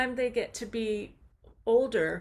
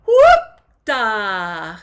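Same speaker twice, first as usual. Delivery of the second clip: falsetto whoop; 15 dB louder than usual. Shown as a demonstration. Teacher demonstrating falsetto whoop for the men. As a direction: toss it up into falsetto. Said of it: "Same with the falsetto in men".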